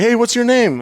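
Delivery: high, insistent retold question; imitated serious question. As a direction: imitate him asking, pitched right up. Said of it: "he asked me serious".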